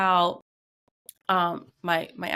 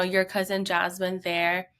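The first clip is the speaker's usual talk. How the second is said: usual speaking voice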